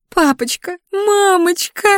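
very high wailing fake cry; hammed-up crying for her parents; fake sobbing. A narrator's reading. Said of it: "наигранно зарыдала она".

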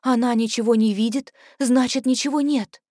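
ordinary delivery

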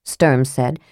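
ordinary delivery, nothing marked

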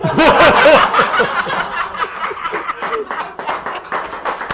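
long, high roar of laughter; loud laughter. Real, not acted. Friends laughing at the secret-agent punchline, long high laughter. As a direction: crack up at the punchline.

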